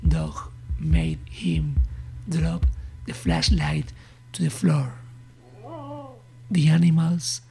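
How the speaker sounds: ordinary delivery, nothing marked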